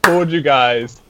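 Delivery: emphatic. Real, not acted. Insisting movie is genius.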